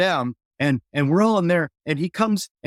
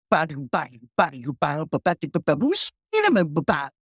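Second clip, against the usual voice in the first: scatted clavinet riff. Retold or imitated. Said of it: "Sly starts going".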